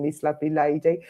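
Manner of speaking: ordinary talk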